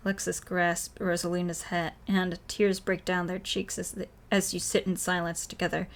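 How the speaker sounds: ordinary talk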